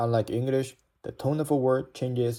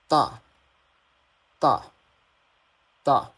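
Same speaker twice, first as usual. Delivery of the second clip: "大" short and loud, dropping steeply; falling tone; 2 semitones higher, 5 dB louder than usual. Shown as a demonstration. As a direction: cut "大" short with a hard fall. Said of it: "the logic is all the same".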